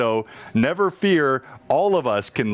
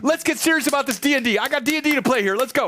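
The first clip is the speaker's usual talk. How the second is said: very high, fast put-on squeal; hammed-up falsetto call to get serious; mock impatience; faster than usual. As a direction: rush it in a silly high voice. Mock impatience to restart the game.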